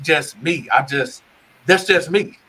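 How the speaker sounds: ordinary delivery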